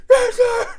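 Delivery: high strained shout of a recruit. Retold or imitated. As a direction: bark back like a scared recruit. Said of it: "The drill sergeants will be like".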